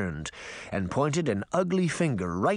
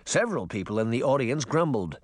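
usual speaking voice

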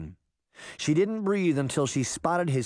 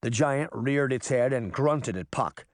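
plain reading voice

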